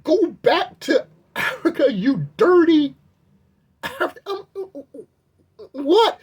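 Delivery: high, slow incredulous repeat; high-pitched shocked retelling; stunned disbelief. Real, not acted. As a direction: quote it back unable to believe it.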